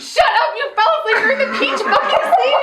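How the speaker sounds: loud, high-pitched outburst with laughter; shocked disbelief. Real, not acted. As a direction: shriek it in amused disbelief. Shocked that friend slept through it.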